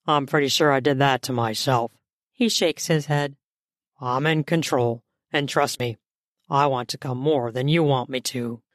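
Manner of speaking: low-pitched man's voice; male lover. Acted. Man reassuring his impatient lover, low male voice. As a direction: play the man, pitched well down.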